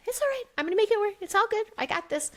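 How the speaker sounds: high-pitched, put-on everything's-fine voice; retold forced optimism, sung out high; brave reassurance. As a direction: chirp mock reassurance at yourself. Retells putting on brave optimism.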